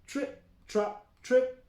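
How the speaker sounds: ordinary delivery, nothing marked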